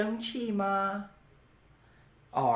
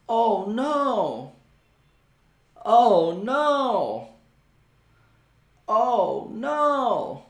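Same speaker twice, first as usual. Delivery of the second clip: higher, louder theatrical oh no; mock dismay; 5 semitones higher, 5 dB louder, slower than usual. Shown as a demonstration. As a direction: act alarmed for the class. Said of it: "哦，不好了".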